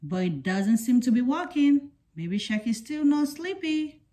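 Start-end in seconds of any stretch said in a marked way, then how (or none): none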